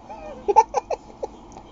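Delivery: a burst of high, gleeful laughter; sudden high-pitched chuckle of delight. Real, not acted. Laughs delightedly after "Jeová.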